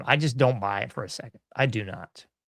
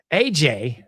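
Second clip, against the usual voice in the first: usual speaking voice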